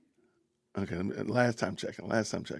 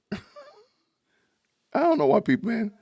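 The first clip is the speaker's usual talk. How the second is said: laughing; 13 semitones higher, 6 dB louder, much slower than usual. Real, not acted. Laughing at being called Grim Reaper.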